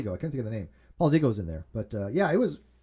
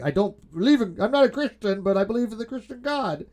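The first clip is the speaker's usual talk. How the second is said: imitating Matt Bell; 7 semitones higher than usual. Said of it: "then I was like".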